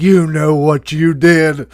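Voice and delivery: accusing stare. Retold or imitated. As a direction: drag it out like a loaded accusation. Accusing the creepy neighbor silently.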